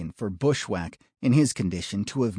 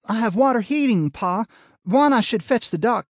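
high, louder voiced daughter; young woman voice; 12 semitones higher, 6 dB louder than usual. A narrator's reading. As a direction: call out to her father. Daughter calls returning.

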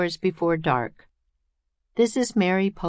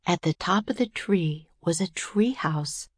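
ordinary delivery

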